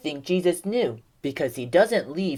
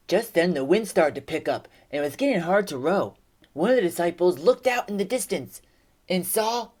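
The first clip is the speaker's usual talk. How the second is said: ordinary talk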